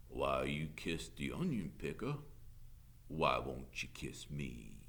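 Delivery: low, drawling Southern voice; taunting sheriff. A narrator's reading. Sheriff pressing the teacher for a kiss, drawling.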